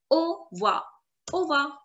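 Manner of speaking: au revoir shortened to "oh-vwa", R E dropped; cut short. Shown as a demonstration. Teacher shows how French people say it, au revoir cut short. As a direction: say au revoir and Ova as a clipped two-syllable "oh-vwa". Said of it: "like to cut this word short".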